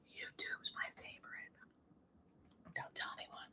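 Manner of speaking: playful confiding whisper; secret whisper. Pretend.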